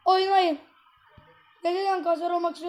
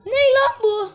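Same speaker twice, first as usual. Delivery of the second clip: higher, louder angry snap at the game; fed-up gamer yelling at the match; angry outburst; 6 semitones higher, 5 dB louder, slower than usual. Real, not acted. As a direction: yell it at the screen in disbelief.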